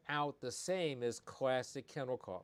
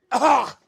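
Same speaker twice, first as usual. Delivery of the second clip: loud hacking dog cough; dog cough imitation; 12 semitones higher, 21 dB louder than usual. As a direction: bark out a sharp retching cough. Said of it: "got a dog goes".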